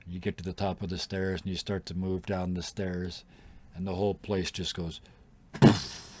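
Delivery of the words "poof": loud breathy poof; thud noise. Acted. GM makes the sound of a body dropping, poof. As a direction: mimic a heavy body falling. GM imitates body hitting floor.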